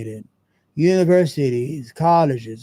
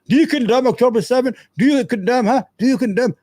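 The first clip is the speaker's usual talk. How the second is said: higher, pushy mock interrogation; mock TV host grilling; 6 semitones higher, faster than usual. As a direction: parody the host demanding an answer.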